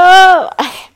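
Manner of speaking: loud, high falling sigh; dramatic high-pitched groaning sigh; exasperated sigh. Real, not acted. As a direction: let out a big exasperated sigh. Loud exasperated sigh mid-thought.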